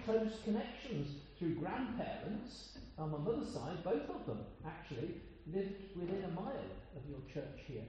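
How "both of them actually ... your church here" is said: normal talking voice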